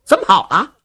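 ordinary delivery, nothing marked

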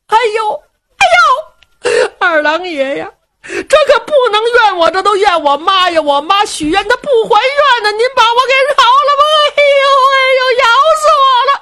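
high, frantic fake wailing and begging; wailing in fake pain. Luo Cheng wails to Erlang god, blaming his mother, in frantic mock pain. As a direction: cry out as if a dog is biting you. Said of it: "就听里边罗成这喊呐".

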